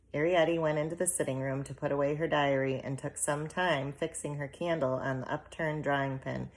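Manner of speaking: ordinary delivery, nothing marked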